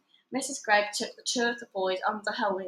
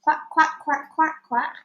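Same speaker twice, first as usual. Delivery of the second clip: a higher, louder quack like a duck; 5 semitones higher, 5 dB louder than usual. A narrator's reading. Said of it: "said a voice at the other end".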